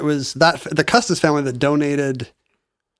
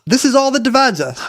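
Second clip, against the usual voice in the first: put-on Southern accent; 8 semitones higher than usual. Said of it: "I love how your Southern accent".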